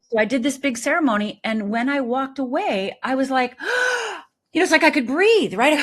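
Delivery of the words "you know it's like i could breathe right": sharp high gasp of relief; relieved gasp. Retold or imitated. Retelling her release, a high gasp of relief. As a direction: gasp like you can finally breathe. Relief after forgiveness ceremony.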